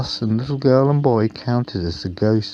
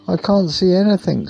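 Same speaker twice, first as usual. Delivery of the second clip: nervous bus; 11 semitones higher, 4 dB louder than usual. A narrator's reading. Scared bus in dark house.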